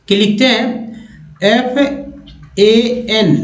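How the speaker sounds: ordinary talk, nothing marked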